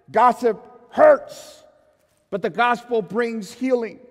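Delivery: loud, rising preacher cadence with every contrast hammered; fiery pulpit delivery; full-force preaching. Acted. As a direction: preach it to the back row.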